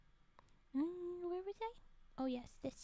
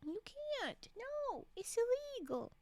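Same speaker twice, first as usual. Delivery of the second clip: higher pouty protest, lightly voiced; pouty whine; 9 semitones higher, slower than usual. Streamer pouts at her model, mild mock whine. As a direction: whine it like a sulky child. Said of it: "A very pouty rage puff".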